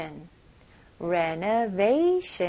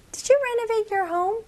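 role voice; 12 semitones higher than usual. Acted. Voiced second person in dialogue.

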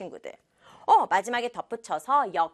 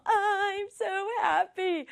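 high voice, I'm so happy; happy tears in a child's voice; 감격한 여동생; tearful happy little sister; 9 semitones higher, much slower than usual. Acted. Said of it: "하고 눈물을 보였죠".

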